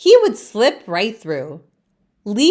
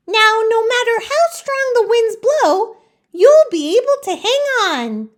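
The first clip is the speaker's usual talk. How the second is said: much higher, bright helper voice; a cheerful friend with a plan, pitched way up; eager friend Laurel; 14 semitones higher, 4 dB louder than usual. Acted. Laurel encourages Leaf, much higher voice. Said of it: "Laurel says".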